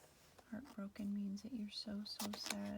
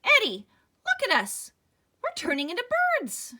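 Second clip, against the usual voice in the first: high, loud panicked shriek; 14 semitones higher, 20 dB louder than usual. Acted. Al waking to find them turning into birds, panicked shriek. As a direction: scream the discovery in terror. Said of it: "Al woke up and shrieked".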